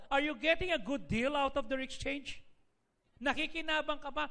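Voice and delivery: ordinary delivery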